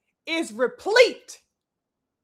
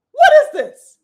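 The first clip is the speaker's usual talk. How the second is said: higher and louder, disgusted yell; outraged shout; 11 semitones higher, 10 dB louder than usual. Real, not acted. Vlogger venting at fighters' behaviour, outraged shout. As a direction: bark it out in disbelief.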